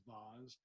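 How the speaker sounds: "vase" said as "vahz", soft and trailing up; fancy vase. Shown as a demonstration. Reader showing her fancy pronunciation, "vase" as "vahz". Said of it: "I was gonna be fancy and pronounce vase as".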